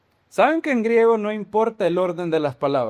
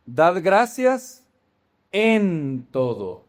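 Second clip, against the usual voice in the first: slow emphatic quote; much slower than usual. Shown as a demonstration. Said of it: "¿Qué significa?".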